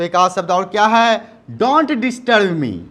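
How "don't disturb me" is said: very slow, word by word; slow teaching model. Shown as a demonstration. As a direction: drill it slowly for the class. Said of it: "teacher models new phrase slowly". (धीरे-धीरे बोलकर सिखाना)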